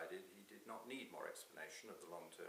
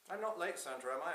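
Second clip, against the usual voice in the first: higher, louder eager question; Prime Minister arriving; 8 semitones higher, 9 dB louder than usual. Acted. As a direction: burst in, anxious to check with Santa. Voices Dave arriving.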